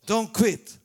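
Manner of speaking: loud preaching cry; loud exhortation. Acted. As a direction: call it out to the crowd.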